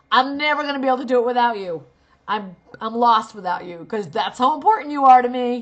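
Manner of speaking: loud mocking plea with laughter; over-the-top fake adoration; sarcastic gushing. Shown as a demonstration. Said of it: "comes out sarcastic".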